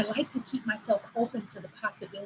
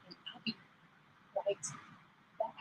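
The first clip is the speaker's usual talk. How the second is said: trails off quieter and farther each word; fading away as if walking off; 17 dB quieter, faster than usual. Shown as a demonstration. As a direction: say it while leaving the mic. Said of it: "And when I say right back".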